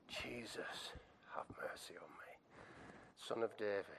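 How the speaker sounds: soft, low, half-whispered appeal; hushed, spent prayer for mercy; quiet plea. Acted. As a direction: beg gently, almost under your breath. Plea repeated softly after shouting.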